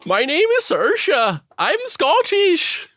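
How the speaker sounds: very high, very slow squeaky Scottish put-on; mock Scottish accent. Mocking co-host's bad Irish attempt, squeaky slow Scottish accent. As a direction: drag out a silly Scottish accent. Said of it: "you tried to do an Irish accent and it was a Scottish accent".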